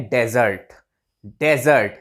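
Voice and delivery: डेजर्ट with Z sound, first syllable "de"; desert pronunciation. Shown as a demonstration. Teacher modelling the word for sandy land, "DE-zert" pronunciation. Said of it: "इसकी pronunciation होती है".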